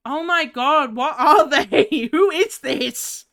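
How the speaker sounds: loud, high, laughing disbelief; baffled outburst. Real, not acted. Streamer stumped by the puzzle picture, laughing disbelief. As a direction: drag it out, loud and incredulous.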